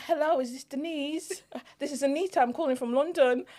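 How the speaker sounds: high-pitched put-on British accent. Shown as a demonstration. Redoing the call to sister on request, in a put-on British accent. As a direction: demonstrate your old British phone voice. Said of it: "Can we do the British accent again?".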